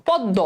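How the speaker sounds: পদ্ম said "pod-do", m silent; doubled d. Shown as a demonstration. Teacher demonstrating to students, পদ্ম with doubled d. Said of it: "এই শব্দটার উচ্চারণ করো".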